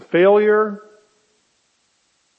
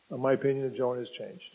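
much softer and quicker, an aside; quiet fast aside; 5 semitones lower, 10 dB quieter, faster than usual. Shown as a demonstration. Preacher confiding a spoiler to the congregation, softer, quick aside. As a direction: drop the voice to confide it. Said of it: "I'll give you a little spoiler".

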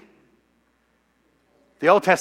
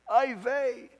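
high, soft exclamation of dismay; a soft, high wail of 'oh no'; dismayed oy vey; 7 semitones higher, 5 dB quieter than usual. Retold or imitated. Convert recalls reacting to Judy's claim, dismayed.

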